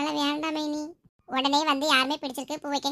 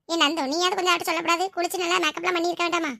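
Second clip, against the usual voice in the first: ordinary delivery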